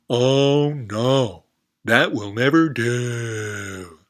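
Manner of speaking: loud, drawn-out frog voice dismissing the idea; loud frog refusal. Acted. Frog turning down the goat, loud and slow. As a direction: stretch out never do like a snooty frog. Frog rejects the goat's answer.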